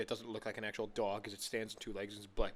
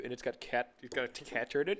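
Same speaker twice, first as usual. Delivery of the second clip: ordinary talk, nothing marked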